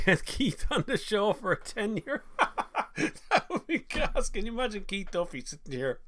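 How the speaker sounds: high laughing voice, slowed. Real, not acted. Host laughing at [name] idea, giggling.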